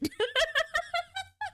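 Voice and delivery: shrieking giggle; high squealing laugh. Real, not acted. Laughing at chat.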